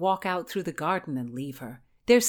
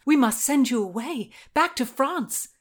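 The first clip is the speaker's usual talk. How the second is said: raised, urgent insistence; urgent alarm; 8 semitones higher, 5 dB louder than usual. A narrator's reading. Margaret tells Anne she must flee, urgently. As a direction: push the pitch up with rising alarm.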